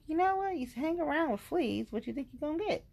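high-pitched sassy telling-off; mock scolding. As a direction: lecture them high and pointed. Acted scolding of the other women.

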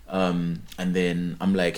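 ordinary delivery

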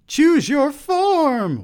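very high, loud, drawn-out shriek; theatrical high-pitched scream; screamed demand. A narrator's reading. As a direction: shriek it slowly as an order. She screams commands at him.

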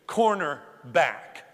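"cornerback" spelled out slowly with a falling tune; unhurried, deliberate "cornerback"; slow emphatic repeat. Shown as a demonstration. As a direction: spell out the word for the non-football crowd.

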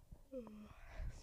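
soft whispered yawn. Real, not acted. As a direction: let out a quiet yawn. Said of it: "sorry, I yawned".

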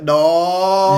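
loud, high mock yell of dismay. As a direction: shout it like a horrified viewer. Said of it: "And you're like".

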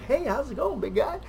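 high, soft mock goofy greeting; jokey greeting. Describing how he isn't a comic, high mock greeting. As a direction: play the class clown for a second. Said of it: "I'm not gonna be like".

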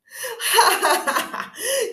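high, loud witch cackle; evil cackle. A narrator's reading. Stepmother after strangling.

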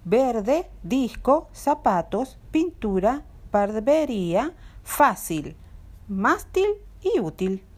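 VERde, DISco, zaPAtos, pinTUra, barbeRÍa, FÁcil, MÁStil, ÚTil; penultimate-syllable stress. Shown as a demonstration. Teacher listing examples of palabras graves, verde to útil stressed on next-to-last syllable. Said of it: "está en la penúltima sílaba".

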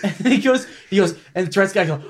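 normal talking voice

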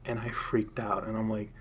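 usual speaking voice

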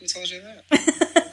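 mock suspicious. Real, not acted. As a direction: playfully interrogate the gossip. Demanding source of gossip.

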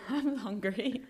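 slow, laughing through food. Real, not acted. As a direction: giggle out the words. Laughing while chewing.

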